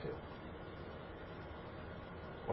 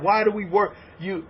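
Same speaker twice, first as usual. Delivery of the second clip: normal talking voice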